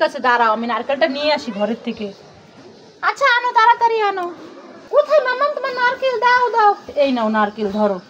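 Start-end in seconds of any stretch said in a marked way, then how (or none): 3.0-6.7 put-on high, hurried kid voice; a kid who can't wait; impatient child. Child hurrying mummy for the coconut, high and impatient.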